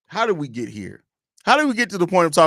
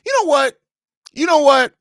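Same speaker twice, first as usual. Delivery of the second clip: excited realisation; 12 semitones higher, 6 dB louder than usual. Real, not acted.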